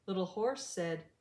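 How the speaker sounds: ordinary delivery, nothing marked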